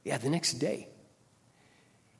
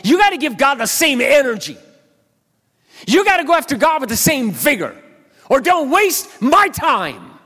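high, loud, pressing preacher challenge; forceful challenge; 12 semitones higher, 9 dB louder than usual. Retold or imitated. Pastor challenging a recovering addict, high loud urging.

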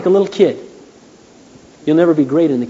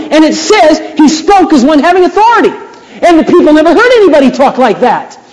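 high, loud sermon delivery; full-force preaching; 10 semitones higher, 6 dB louder than usual. Real, not acted. Preacher insisting Jesus was a man of power, full-force preaching. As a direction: proclaim it forcefully, pitched well up.

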